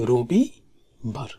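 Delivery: very slow, with gaps between syllables. Shown as a demonstration. Teacher models Sunday in both languages, slow syllable by syllable. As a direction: break the word up for learners. Teacher spaces out the weekday name.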